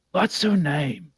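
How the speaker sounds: ordinary talk, nothing marked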